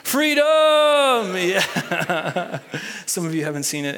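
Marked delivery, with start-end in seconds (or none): 0.0-0.5 high, loud shout of freedom; Braveheart battle cry. Pretend. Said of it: "say it in your best Braveheart voice". 0.5-1.3 laugh. Real, not acted. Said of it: "just for fun".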